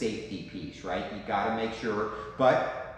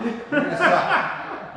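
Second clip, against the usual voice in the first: laughing mock warning; 10 dB louder, slower than usual. Mock golf lesson repeated.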